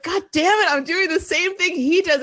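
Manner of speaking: high, exasperated self-realization; mock dismay. Friend realizing she copies her dad, high mock dismay. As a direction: catch yourself mid-sentence, horrified. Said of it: "And I was like".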